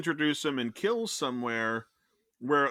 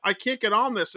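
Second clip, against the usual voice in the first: baffled exasperation; 7 semitones higher, 6 dB louder than usual. Real, not acted. Ranting, baffled by the film.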